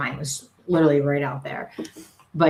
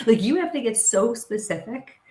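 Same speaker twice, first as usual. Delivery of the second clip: ordinary delivery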